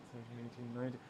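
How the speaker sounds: soft muttered count; counting under breath. Real, not acted. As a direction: count quietly to yourself. Counting boxes under his breath.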